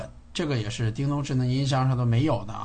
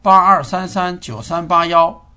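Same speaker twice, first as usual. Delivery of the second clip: loud clear dictation; 3 semitones higher, 8 dB louder than usual. Real, not acted. Dictating a number to speaker.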